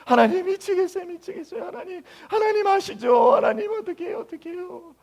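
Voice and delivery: high, whimpering hammed-up sobbing plea; mock tearful prayer. Preacher mimicking a believer weeping over a crisis, hammed-up crying. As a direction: imitate a desperate believer crying to God, voice high and breaking. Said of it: "막 울면서 기도하는 거예요".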